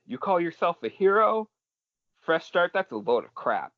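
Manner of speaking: high-pitched indignant outburst, easing off at the end; acted-out angry yell, starting very high; Twice yelling. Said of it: "And he yells".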